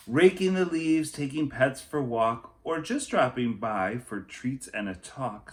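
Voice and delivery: put-on lively read-aloud for children; picture book reading. A narrator's reading. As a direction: tell the story to a class.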